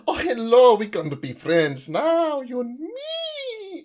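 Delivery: high, eager "new best friend" voice, dropping to a slow hush at the end; sudden friend on the metro; overly friendly stranger. Retold or imitated. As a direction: gush at them like a cheery new pal. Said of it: "you're like".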